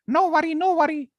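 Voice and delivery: very high, squeaky grateful mouse; tiny squeaky mouse thanking; shrill mouse voice. Acted. Mouse thanks the lion for saving it, squeaky. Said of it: "It was shrill".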